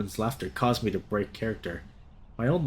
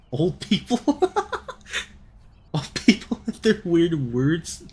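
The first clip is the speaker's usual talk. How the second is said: laughing; 7 semitones higher, 3 dB louder, slower than usual. Real, not acted. Mocking old folks' words.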